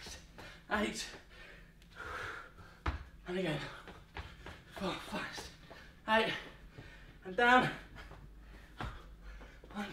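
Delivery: breathless counting between reps; panting out the count mid-exercise; out of breath. Real, not acted. Counting reps mid-workout, gasping.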